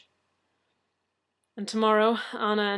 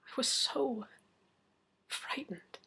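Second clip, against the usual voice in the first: broken whisper; 3 semitones higher, 8 dB quieter, slower than usual. Acted. Confessing fear, voice fails.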